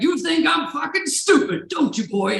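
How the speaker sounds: high, loud, angry father voice; mock furious holler; shouting dad. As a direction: imitate an irate American dad berating his son. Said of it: "like, shouting with his dad".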